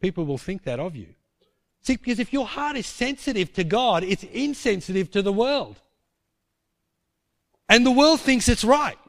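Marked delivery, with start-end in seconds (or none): 1.8-9.0 higher, louder pulpit voice; preaching. Acted. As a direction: rouse the congregation. Preaching to the congregation.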